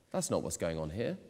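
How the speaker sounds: ordinary delivery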